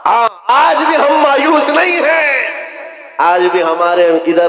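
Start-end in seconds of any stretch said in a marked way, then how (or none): 0.0-2.5 fiery preaching. Acted. Preaching climax.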